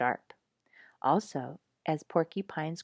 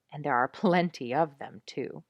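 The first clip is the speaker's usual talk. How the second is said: usual speaking voice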